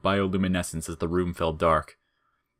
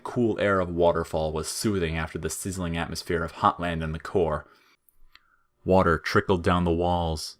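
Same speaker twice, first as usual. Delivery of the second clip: ordinary delivery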